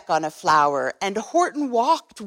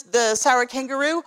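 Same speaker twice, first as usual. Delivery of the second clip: ordinary delivery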